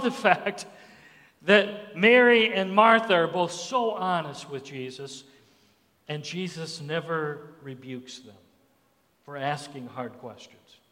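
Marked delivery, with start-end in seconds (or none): none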